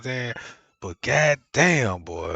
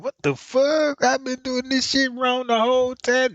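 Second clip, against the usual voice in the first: pitched way up in amazement; shocked disbelief; 10 semitones higher, 4 dB louder than usual. Retold or imitated. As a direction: cry out in sudden realization. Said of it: "I'm like".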